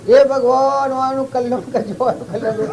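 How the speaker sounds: high pleading cry to God; calling out to God. Retold or imitated. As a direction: cry out to God from behind the palanquin. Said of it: "એમ બૂમો પાડે".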